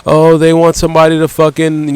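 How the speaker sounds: normal talking voice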